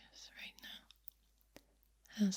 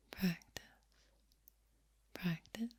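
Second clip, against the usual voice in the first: soft speaking. Shown as a demonstration. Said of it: "practice with soft speaking".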